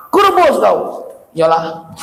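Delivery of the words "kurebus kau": mock threat.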